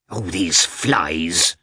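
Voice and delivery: slow, drawn-out grumble of annoyance; fed up with the flies, mildly cross. A narrator's reading. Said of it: "cried Agafya Mikhailovna crossly".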